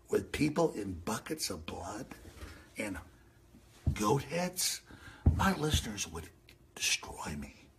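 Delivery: low fading murmur; hushed. Real, not acted. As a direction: drop it down to a hush. Hushed imagining.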